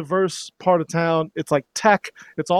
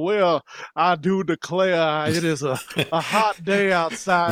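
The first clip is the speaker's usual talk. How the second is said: slow, drawn-out mock Southern belle accent; slower than usual. Mocking outsiders' idea of Georgians with a slow Southern belle accent. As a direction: ham up an old Southern belle accent, slowly. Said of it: "a Paula Deen accent".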